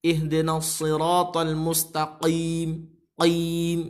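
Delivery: "mustaqim" with overdone heavy q, drawn out; slow chant, overdoing the q in "Qim"; exaggerated qaf. Shown as a demonstration. Teacher warns against excess, "mustaqim" overdone. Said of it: "jangan terlalu berlebihan".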